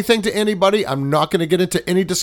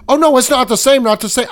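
mock flustered denial; 7 semitones higher, 5 dB louder than usual. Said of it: "He goes".

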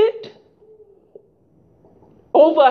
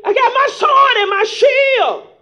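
emphatic battle cry; 10 semitones higher than usual. Retold or imitated. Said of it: "Ain't that what y'all say?".

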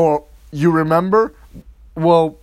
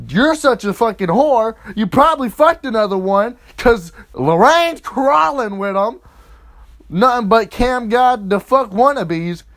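raised, higher-pitched accusing rant; angry tirade; 6 semitones higher, 4 dB louder than usual. Acted. Boyfriend accusing pregnant girlfriend of cheating, angry tirade. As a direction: lay into her, loud and worked up.

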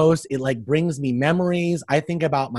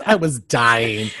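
ordinary talk, nothing marked

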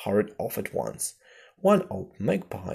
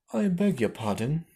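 mild apology, pitched up; very humble; 6 semitones higher, 3 dB louder than usual. A narrator's reading. Alice apologising to the mouse, very humbly. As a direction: beg pardon meekly, voice lifted. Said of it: "said Alice very humbly".

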